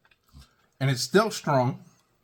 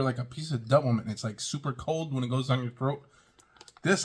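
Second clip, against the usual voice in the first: usual speaking voice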